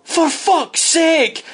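slow, high exasperated outburst in a put-on voice; exasperated coach. Coach finally giving up on his player, slow high exasperation. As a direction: drag it out like a fed-up manager. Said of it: "and Archie went".

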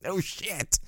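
whispered, dry and sarcastic; a hushed sarcastic no shit. Retold or imitated. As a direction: breathe it out like a muttered aside. Mocking aside about speech.

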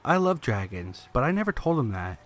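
ordinary delivery

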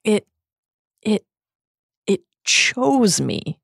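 halting, high, agonized stammer; slow, broken, anguished plea; Missus Cattermole sobbing. Acted. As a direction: drag it out between tearful gasps. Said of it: "sobbed Missus Cattermole".

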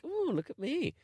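high, soft mock-impressed coo; playful self-admiration. Recalling driving at night, playfully pleased with himself. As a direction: marvel at yourself in a high voice. Acting out his own proud thought.

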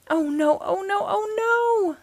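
rising, high-pitched wail of dread; panicked cry. A narrator's reading. Ant about to be squished by a shoe, panicked wail.

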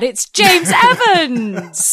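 drawn-out, high announcer flourish through a laugh; host's grand slow reveal, laughing; laughing announcement. Real, not acted. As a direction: announce his name slowly, cracking up. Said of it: "joining me is a stubborn old man".

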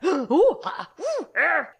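high gasping ooh and ah sounds; very high, loud mock panic gasps; mom's panicky gasps. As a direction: imitate a mother fighting off panic. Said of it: "trying not to panic over there".

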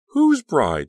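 high, loud cry of alarm; cried out. A narrator's reading. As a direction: cry out in shock. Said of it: "Danielle cried".

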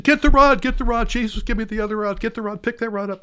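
high frantic yelling at the client to grab the rod; panicked guide shouting at his client to get the rod, frantic yell. Retold or imitated. Yell it in a panic, pitch way up.

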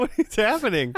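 high, laughing protest; baffled, laughing through the words; laughing bewilderment. Real, not acted. Laughing protest at being gaslit.

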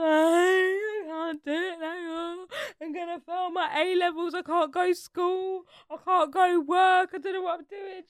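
high, loud drawn-out mock wail; hammed-up tearful meltdown, stretched words; retold crying. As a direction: wail it out like an overwrought teenager. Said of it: "crying for the mum".

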